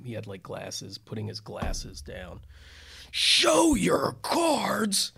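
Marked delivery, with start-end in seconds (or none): 3.1-5.1 much higher, louder and very slow, a booming bark; drawn-out booming roar of an angry judge; judge's booming outburst. Retold or imitated. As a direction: thunder it out like the furious judge.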